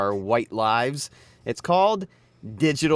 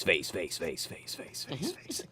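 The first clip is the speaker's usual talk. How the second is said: fading, softer repeated "face" like an echo; mock echo; 7 semitones lower, 11 dB quieter than usual. Host faking an echo effect, fading away.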